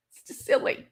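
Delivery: breathy high laugh through the words; giggling out the line. Real, not acted. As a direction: laugh it off as silly. Laughs at prank-call memory.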